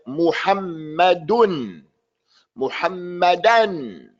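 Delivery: "Muhammadun" drawn out, double m held; slow "Muhammadan" with a long, pressed m; correct shadda. Shown as a demonstration. Teacher modelling for the adhan caller, slow, held double m. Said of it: "he has to say it correctly".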